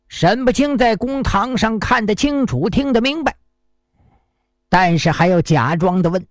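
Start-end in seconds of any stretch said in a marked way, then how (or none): none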